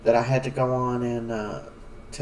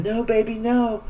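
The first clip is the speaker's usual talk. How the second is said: high, pleading no; high mock-anguished plea; retold desperate scream; 8 semitones higher than usual. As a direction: beg her not to in a high cry. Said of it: "Talia's screaming".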